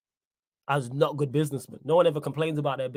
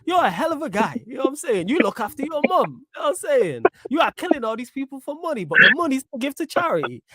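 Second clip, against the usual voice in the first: high, loud gushing praise; mock villain admirer; 10 semitones higher, 4 dB louder than usual. Host mocking co-host's praise of villains, high exaggerated admiration. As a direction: mimic your co-host fawning over a bad guy. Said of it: "You will see the villain, the bad guy, and go".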